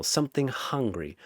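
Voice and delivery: usual speaking voice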